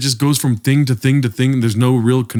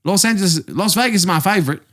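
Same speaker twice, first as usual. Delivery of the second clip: higher-pitched, nonstop mock Australian chatter; Australian accent; 5 semitones higher than usual. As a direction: ramble on in a bad Australian accent. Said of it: "He would not stop talking".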